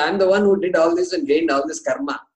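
usual speaking voice

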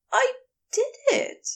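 high, thrilled cry; excited. Acted. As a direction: burst out with pride and joy. Aziraphale proud of himself.

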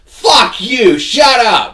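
very high, loud imitation outburst; mock furious yell. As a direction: scream it back like an outraged customer. Acting woman cursing back.

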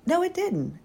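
high surprised no; self-correcting. Real, not acted. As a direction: catch the mistake mid-sentence. Corrects herself.

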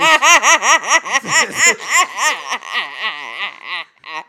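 loud fit of laughter; long helpless laugh. Real, not acted. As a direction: cracks up, can't stop laughing. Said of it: "I can't keep a straight face".

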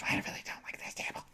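quick imitated whisper; retold whisper. Roger confiding once the salesman left, quick mock whisper. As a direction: confide it fast under your breath.

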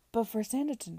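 a touch of aghast host pleading with a stubborn guest. A narrator's reading. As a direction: burst out in dismay. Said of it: "Mister Parker was no less aghast".

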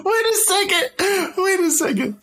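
high, slowed stop in disbelief; surprised. Real, not acted. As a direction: hold on, you can't be serious. Stunned listener stops the story.

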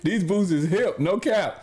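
high, hammed-up slang brag; trying too hard cool. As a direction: flex it with a laugh in your voice. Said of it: "hit her with the".

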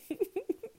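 high giggle; laugh. Real, not acted. Laughing at her friend's blunt advice, high giggle. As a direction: let a giggle slip out. Laugh after Judy's reply.

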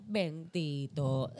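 very slow stretched-out Bendito in a put-on old lady voice; a slow, sighing Bendito from a tipsy old lady; mock pitying bendito. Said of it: "la señora empieza".

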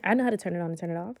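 ordinary delivery

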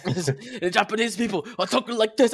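put-on Japanese accent, played for mockery. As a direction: mimic a comic's hammed-up Japanese accent. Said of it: "she did a funny accent".

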